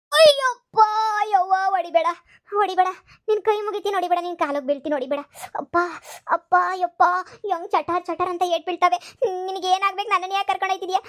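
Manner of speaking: high, whimpering begging to stop the blows; hammed-up pleading. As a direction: beg the ghost for mercy. Grandma begging ghost not to hit.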